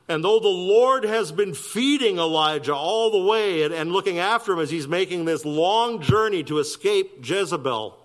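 rising sermon intensity; preaching. Acted. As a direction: drive the story home from the pulpit. Preacher builds to full force.

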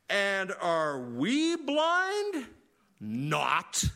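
slow, stretched mock-indignant "And are we blind? Not."; indignant Pharisees. Preacher mocking the Pharisees' reply, slow and indignant. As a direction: drag it out like a smug scoffer. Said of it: "that's about as contemporary as I get sometimes with idioms".

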